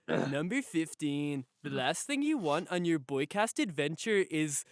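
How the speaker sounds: drawn-out countdown narrator impression; Chills. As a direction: announce it slowly like a ranking-video host. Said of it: "my favorite easy voice to do".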